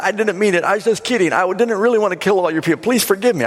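high, hurried begging; mock desperate pleading. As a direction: act out a villain scrambling for excuses. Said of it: "plead and beg desperately".

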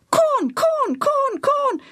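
very high parrot squawk. A narrator's reading. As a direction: mimic like an eager bird. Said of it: "Bobo said".

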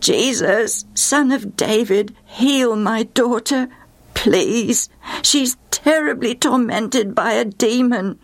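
slow, stretched-out imploring call. Acted. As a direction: draw each phrase out as you beg. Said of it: "she began to call out".

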